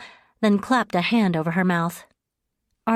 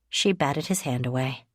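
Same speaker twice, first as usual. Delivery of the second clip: usual speaking voice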